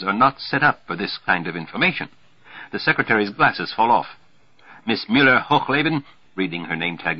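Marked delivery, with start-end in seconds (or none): 4.9-6.0 raised, loud mock-Nazi address; lightly hammed-up Nazi officer; comic Nazi routine. A narrator's reading. Roger reading the secretary's name tag, comic Nazi. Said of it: "This comic Nazi routine".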